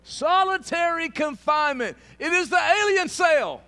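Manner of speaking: very loud, high preaching shout. Real, not acted. Preacher naming the prison of rejection, shouted out. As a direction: proclaim it at full force.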